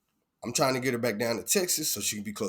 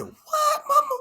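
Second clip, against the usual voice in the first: high cracking cry of disbelief; 5 dB louder, slower than usual. Retold or imitated. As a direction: wail it up high to mama. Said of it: "I said".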